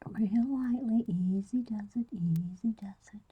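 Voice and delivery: soft whisper, close to the mic. Real, not acted. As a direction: whisper as you draw. Whispers while drawing.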